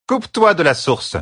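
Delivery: sharp high-pitched command; ordering. A narrator's reading. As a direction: order it firmly, pitch well up. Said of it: "ordonna-t-elle".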